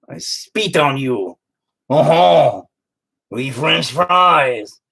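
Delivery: slow, hammed-up French accent; jokey put-on French accent; mock French accent. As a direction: sneer it like a snooty Frenchman, slowly. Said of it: "I'm like".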